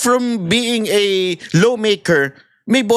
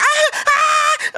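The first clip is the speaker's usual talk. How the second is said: very high shrieks of alarm; hammed-up bystander screams; mock screaming. Onlooker joining a commotion, very high mock screams. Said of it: "sumisigaw siya".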